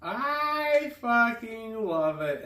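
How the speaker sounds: delighted. Real, not acted. Delighted reaction to the note.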